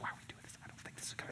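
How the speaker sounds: hushed worried whisper; whispering wife. Retold or imitated. Wife whispering her doubts to her husband in line. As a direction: whisper the doubt under your breath. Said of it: "And she's like".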